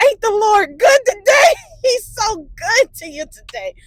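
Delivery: loud, high, drawn-out church-style rejoicing; mock jubilant praise. Daughter phoning mom after dodging a ticket, jubilant praise. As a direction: crow it out slowly, laughing midway.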